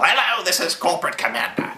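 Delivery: high, put-on villain announcer voice; imitating a costumed commander's grand introduction; Corporate Commander spiel. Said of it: "he launches into his spiel".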